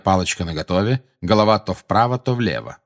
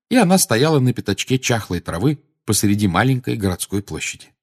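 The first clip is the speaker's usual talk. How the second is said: ordinary delivery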